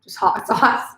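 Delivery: usual speaking voice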